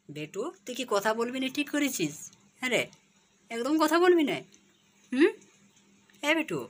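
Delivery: high, loud teasing plea to a stubborn bird; high playful scolding of a pet; pleading pet talk. Real, not acted.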